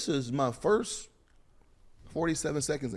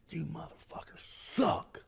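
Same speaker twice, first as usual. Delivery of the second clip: slow, hushed, mocking the coach's line; whispered imitation of the furious head coach; 4 semitones higher, 9 dB quieter, slower than usual. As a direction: hiss it like an angry coach under his breath. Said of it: "Motherfucker coming at motherfucker".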